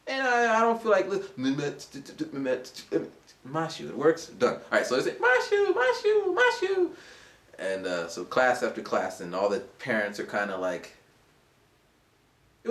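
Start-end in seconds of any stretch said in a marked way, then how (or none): 5.2-6.9 high, loud repeated call; high excited chant of the name; students calling out. Retold or imitated.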